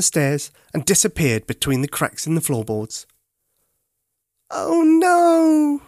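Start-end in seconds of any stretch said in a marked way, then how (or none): 4.5-5.8 high, loud dismayed cry; a little bear's wail of despair. A narrator's reading. Teddy losing his coins down the stairs, crying out high and loud. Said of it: "he cried".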